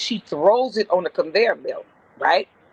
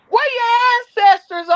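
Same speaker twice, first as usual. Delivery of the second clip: high, raised-voice question; calling after; 4 dB louder than usual. Retold or imitated. Old lady calling after a coworker who walks on, high retold holler. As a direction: call it out after someone walking away.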